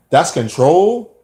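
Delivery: drawn-out, rising disbelief; incredulous question. Acted. Man challenging a housemate who called him controlling, incredulous.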